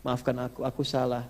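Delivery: put-on a child sobbing sorry to his mother; tearful child. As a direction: son begging his mother's forgiveness, softly tearful. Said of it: "dia nangis".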